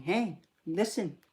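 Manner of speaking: slow, high, attention-seeking fairy call; drawn-out sprite voice impression; fairy from Zelda. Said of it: "being like that fairy from Zelda that's saying".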